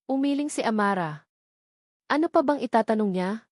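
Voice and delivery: ordinary delivery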